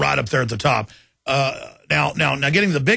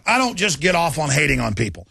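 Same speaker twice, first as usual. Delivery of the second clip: ordinary talk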